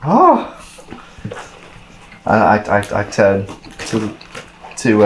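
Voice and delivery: normal talking voice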